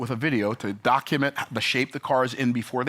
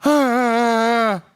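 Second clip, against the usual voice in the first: loud, higher exasperated "Ah"; frustrated groan; 8 semitones higher, 7 dB louder than usual. Retold or imitated. Owner finds his other car's dash separated, groans. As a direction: let out a loud fed-up groan. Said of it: "I'm like".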